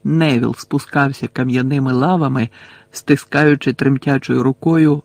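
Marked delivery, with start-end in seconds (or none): none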